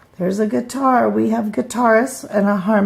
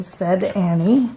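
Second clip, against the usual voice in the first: ordinary delivery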